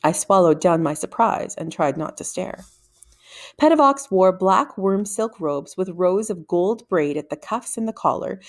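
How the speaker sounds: usual speaking voice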